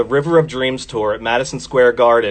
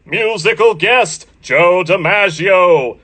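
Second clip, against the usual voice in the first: slow, booming game show announcer; 6 semitones higher, much slower than usual. Retold or imitated. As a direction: draw out each name like an old TV announcer. Don Pardo announcer voice singing.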